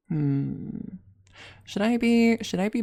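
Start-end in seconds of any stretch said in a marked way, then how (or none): none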